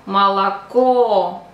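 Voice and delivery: молоко with the final O stressed and drawn out, first two as A; stress on last O. Shown as a demonstration. Teacher showing the accent is on the third O, stressed ending. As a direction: slowly say malaKOO for молоко. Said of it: "the accent is on the third O".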